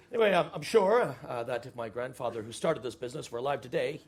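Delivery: normal talking voice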